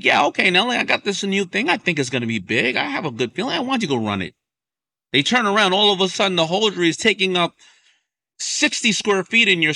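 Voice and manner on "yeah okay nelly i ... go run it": bright, high eager pitch of a new idea. Retold or imitated. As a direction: sell the idea with rising enthusiasm. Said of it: "they were like".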